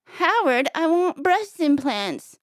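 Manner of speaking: lightly voiced wife. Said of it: "the man voice still sound like her".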